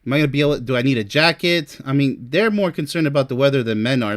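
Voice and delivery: normal talking voice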